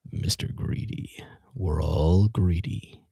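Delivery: slow, voiceless whisper; conspiratorial whisper. Acted. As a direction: whisper it like a dark secret. Whispered dream punchline.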